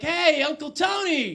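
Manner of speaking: high, drawn-out greeting call; loud, stretched hello. Acted. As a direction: call loudly to get your uncle's attention. Calling out to uncle in kitchen.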